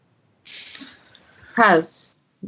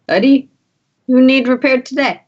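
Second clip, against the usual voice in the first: higher, louder droid voice; brisk droid character; 7 semitones higher, 4 dB louder than usual. Acted. Repair droid asking who needs fixing, high loud character voice. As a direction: pitch it up like an eager repair droid.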